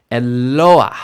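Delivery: e-LO-ah, loud punch on "lo"; penultimate stress. Shown as a demonstration. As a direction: hit the "lo" of Eloah hard. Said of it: "it moves to the penultimate position".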